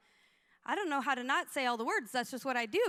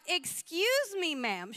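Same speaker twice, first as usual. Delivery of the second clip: indignant challenge; much slower than usual. Retold or imitated. Pastor to lady who shoved her friend, indignant imitation. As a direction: stretch it into an affronted call-out.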